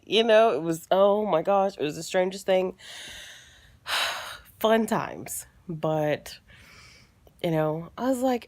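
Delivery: ordinary talk, nothing marked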